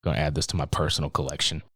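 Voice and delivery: low, soft, sleazy muttering; mock creepy mutter. Host mocks the assistant's creepy line, low and soft. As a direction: lean in like it's a secret. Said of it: "the way he says".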